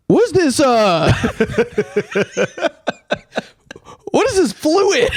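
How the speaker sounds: very high, baffled question broken by laughter; hammed-up baffled mechanic; puzzled mechanic. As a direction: act the confused guy sniffing the puddle.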